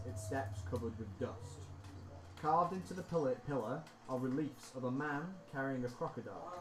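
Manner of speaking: ordinary talk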